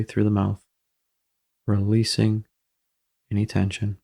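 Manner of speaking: calm, even meditation voice with long pauses; guided meditation. Acted. Guiding listeners to watch their breath, calm meditation delivery. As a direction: ease the listener into the breath, unhurried.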